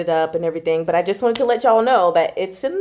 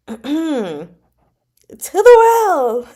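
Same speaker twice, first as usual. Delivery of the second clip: catchphrase; 6 semitones higher, 7 dB louder, much slower than usual. Acted. Meme catchphrase punchline.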